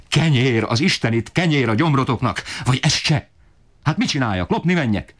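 a desperate father ranting at the ceiling; climbing, high-pitched angry tirade; furious outburst. A narrator's reading. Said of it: "dühétől még jobban megdühődve".

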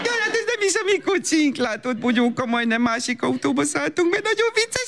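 high, laughing sing-song to cover the blunder; flustered mother turning a mix-up into a game for her child; baby talk. Acted. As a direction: gush at the toddler to laugh it off.

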